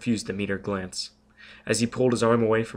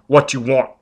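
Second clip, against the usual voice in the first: threatening growl; 3 semitones higher, 9 dB louder than usual. A narrator's reading. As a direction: menace him with a growl. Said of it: "Foyle growled".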